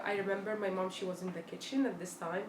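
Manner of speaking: ordinary talk, nothing marked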